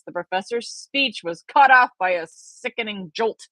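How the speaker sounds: usual speaking voice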